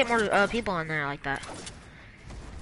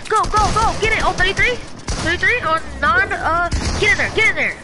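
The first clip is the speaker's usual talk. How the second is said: frantic callouts; 7 semitones higher, 5 dB louder than usual. Real, not acted.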